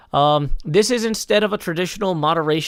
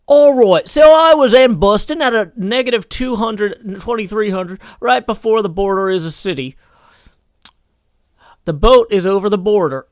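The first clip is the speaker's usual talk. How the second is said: higher, louder put-on Kansas accent; 5 semitones higher, 8 dB louder than usual. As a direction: read it out in a hammed-up Kansas accent. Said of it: "I was requested to try to do this in a Kansas accent".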